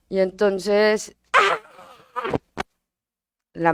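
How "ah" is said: imitated child's wordless scream; grito infantil. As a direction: cry out like a frustrated little boy. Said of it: "Eran unos gritos". (grito de niño sin palabras)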